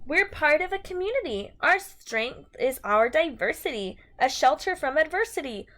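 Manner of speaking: bright, sing-song picture book reading; reading to children. Acted. Teacher reading a rhyming book to her class, sing-song. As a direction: read the rhyme to a class, lilting and lively.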